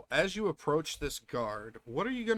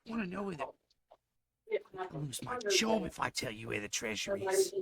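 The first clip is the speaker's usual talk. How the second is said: put-on Aussie accent; 5 semitones higher, 4 dB quieter than usual. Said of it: "He sounds thickly Aussie".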